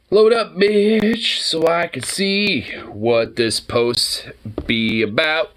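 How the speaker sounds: slow drawn-out urging. Acted. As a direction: stretch it out slowly, impatient. Urging app to load.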